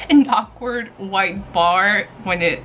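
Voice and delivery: usual speaking voice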